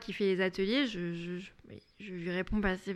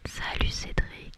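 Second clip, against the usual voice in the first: asmr whisper; slower than usual. Pretend. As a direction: whisper the greeting gently. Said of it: "spécial A S M R".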